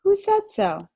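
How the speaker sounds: put-on challenging little girl. A narrator's reading. Lucy challenging Edmund's claim about fauns, high indignant girl. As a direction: demand to know who said it.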